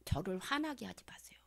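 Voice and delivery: soft whispered warning. Real, not acted. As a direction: hush it like a quiet threat. Pastor warns congregation softly. (속삭이며 경고하듯)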